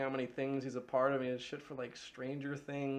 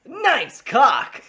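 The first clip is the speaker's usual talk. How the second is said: high loud punchy line; crisp Full Throttle quote; 13 semitones higher, 22 dB louder than usual. Shown as a demonstration. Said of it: "really crisp whenever I say it".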